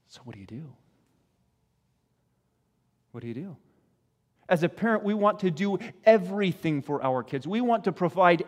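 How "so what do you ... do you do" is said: soft question. Real, not acted. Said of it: "quiet rhetorical question".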